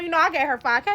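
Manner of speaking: ordinary delivery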